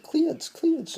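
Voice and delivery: "Cleats, cleats" high and loud with a clipped ee vowel; South African "clothes". Retold or imitated. Stepmom insisting on her word, loud high mock accent. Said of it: "And she's like".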